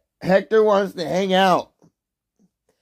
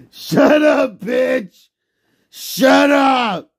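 higher, louder shut up; angry shout; 6 semitones higher, 3 dB louder, much slower than usual. Real, not acted. Streamer yelling at someone nearby, angry shout. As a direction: snap it at them.

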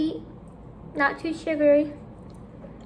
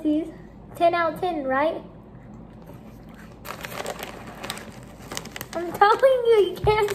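muffled through covering hand; chewing while talking; mouth full, hand over mouth; 3 semitones higher than usual. Real, not acted. Seen 0: Girl puts food in, covers mouth with hand while talking.

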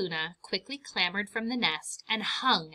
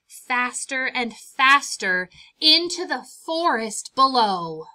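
slow and much louder; building urgency; 4 semitones higher, 9 dB louder than usual. A narrator's reading. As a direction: swell it as she plummets. Building fall.

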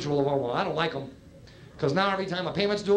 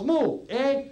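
Canadian cow; 6 semitones higher than usual. Retold or imitated. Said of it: "Cow goes".

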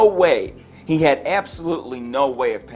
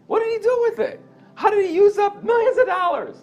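pitched way up in disbelief; incredulous. Real, not acted. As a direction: ask it as if you can't believe it. Incredulous rhetorical questions.

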